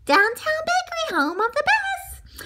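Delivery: high singsong telephone greeting; bakery phone greeting. A narrator's reading. Bakery picks up a call, high singsong greeting. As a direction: answer the phone in a high chirp.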